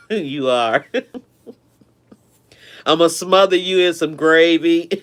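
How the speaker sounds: real chuckle breaking between the words; laughing. Real, not acted. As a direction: tease a friend, laughing as you talk. Joking banter with Calvin, laughing.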